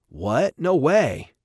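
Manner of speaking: loud disbelief, leaping high; shocked disbelief. Acted. Rob hearing he may lose his team, shocked. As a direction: burst out in shocked protest.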